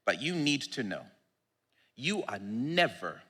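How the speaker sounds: normal talking voice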